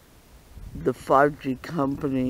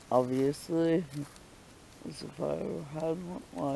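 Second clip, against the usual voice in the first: ordinary delivery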